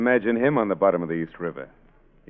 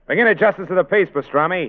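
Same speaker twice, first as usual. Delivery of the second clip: calling out; 10 semitones higher, 4 dB louder than usual. Acted. Boss shouting an order to henchman.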